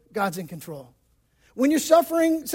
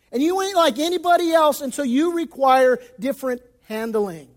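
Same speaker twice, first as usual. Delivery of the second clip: usual speaking voice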